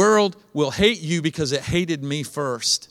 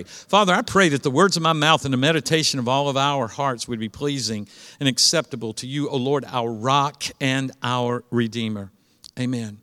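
prayer; 6 semitones lower than usual. Real, not acted. Pastor praying over the congregation, hushed.

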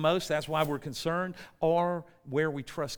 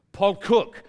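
loud, higher, exasperated name call; mock French clerk giving in loudly; fed-up call; 4 semitones higher, 8 dB louder than usual. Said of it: "she gets fed up with me".

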